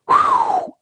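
high breathy whew; whew of relief. Real, not acted. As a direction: blow off the tension. Relief exhale.

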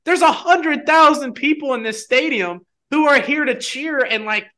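high, rising indignant rant, pitch way up; heated rant. Retold or imitated. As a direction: defend your right to yell, getting shriller. Ranting about being told to calm.

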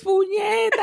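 high mock tantrum curse; bridezilla outburst.

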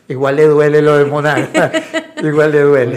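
bursts of laughter over the words; laughing while quoting. Real, not acted. Father quoting his son about Monagas, laughing. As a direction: laugh as you repeat it. Son's quote, coach laughing.

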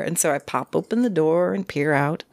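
normal talking voice

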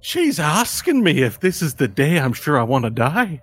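high, swooping, incredulous repeat; mocking thug. Thug mocks the girl's threat to his boys, high jeering voice. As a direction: pitch it up and play to your gang.

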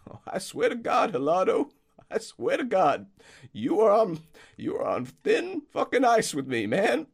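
put-on high, loud, distraught old man; fretting groundskeeper. Groundskeeper finding his friend Peng hurt, distraught high voice. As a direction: panic over your injured friend.

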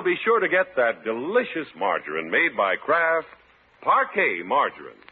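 ordinary talk

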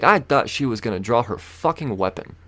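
ordinary delivery

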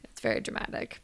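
unvoiced whisper; whispered comment; whispered aside. Real, not acted. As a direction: breathe it like a secret. Aside on the drama.

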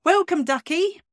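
high, sing-song welcome, ducky; a cheeky high "welcome, ducky" with no real accent; playful greeting. Shown as a demonstration. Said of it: "I need to say that in a Yorkshire accent".

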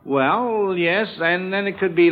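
usual speaking voice

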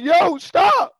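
sudden high shout of stop; yelled stop. Retold or imitated. Shouting at his friend who grabbed him in the store, loud and high. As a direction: yell it out like you've been grabbed. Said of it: "I was like".

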